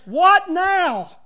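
mock frustration from a listener; exasperated what now. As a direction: fling the question out in dismay. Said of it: "Someone says".

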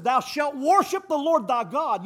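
loud, slow proclaiming; preacher declaring the command; preaching scripture. Acted. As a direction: pronounce the commandment from the pulpit.

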